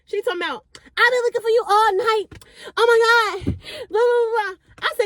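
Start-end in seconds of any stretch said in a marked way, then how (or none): 0.8-4.5 high, excited friend voice; tipsy friend gushing on finding her; drunk friend. Retold or imitated.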